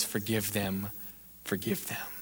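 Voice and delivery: low, very soft plea; hushed forgiveness. Acted. Preacher as Jesus forgiving the crowd, hushed. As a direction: let it drop almost to nothing.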